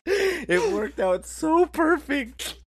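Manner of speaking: high, drawn-out words squeezed through laughter; real laughing. Real, not acted. Telling friends the prank worked out, helpless high laughter. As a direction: barely get it out for laughing.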